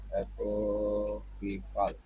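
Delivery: quiet, distant recorded pronunciation; soft playback of the word from a phone; dictionary audio playing. Real, not acted. Teacher checks apocryphal. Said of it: "উচ্চারণটা একটু দেখে নিই".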